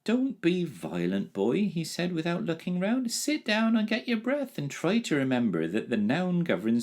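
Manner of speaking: put-on a calm dragon gently chiding the boy; lightly voiced dragon. A narrator's reading. Dragon voice without looking round.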